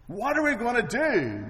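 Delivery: high, loud frightened plea; panicked cry. Retold or imitated. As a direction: cry it out like a terrified servant. Servant's panic repeated.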